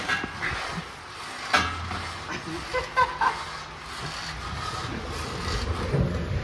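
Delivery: long airy unvoiced laugh; breathy laughter. Real, not acted. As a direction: wheeze with laughter. Laughing at friend's struggle.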